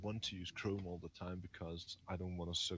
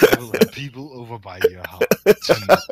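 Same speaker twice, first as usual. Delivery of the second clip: louder, higher, fast hammed-up menace; mock threat; 4 semitones higher, 21 dB louder, faster than usual. Jokingly threatening his friend over Linux, loud mock menace. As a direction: threaten him for laughs.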